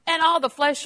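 loud, laughing, then shouting in rising joy; preaching shout. Real, not acted. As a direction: proclaim it to the whole church at full force. Preacher proclaims God's power.

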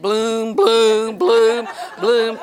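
loud, heavy booming of a throbbing head; pounding bloom. Retold or imitated. Preacher imitating a throbbing headache, booming. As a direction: boom each one like a headache beat.

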